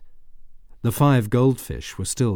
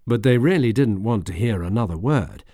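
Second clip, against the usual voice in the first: usual speaking voice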